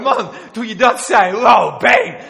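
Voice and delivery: loud, high, excited shout of praise; mock enthusiastic listener. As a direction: cheer as if blown away by the sermon. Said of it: "Dan wil je horen".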